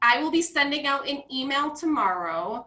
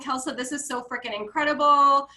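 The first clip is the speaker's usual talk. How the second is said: ordinary delivery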